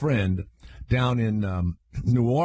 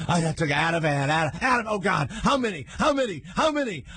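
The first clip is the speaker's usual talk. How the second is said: climbing higher and higher in mock panic; rising panic; 7 semitones higher than usual. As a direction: spiral up into hysterical confusion. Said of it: "I go".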